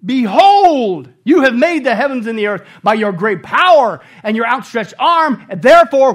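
loud, driving sermon delivery; fervent pulpit proclamation; full-force preaching. Acted. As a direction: preach it hard to the congregation.

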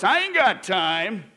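loud, drawn-out mimicked excuse; mock excuse. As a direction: whine it out slowly, mocking the excuse-maker. Mimics believer's excuse for not serving.

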